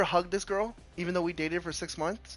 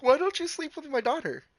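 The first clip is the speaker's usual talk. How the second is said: mother's voice; 10 semitones higher than usual. Retold or imitated. Mother repeating her blunt question.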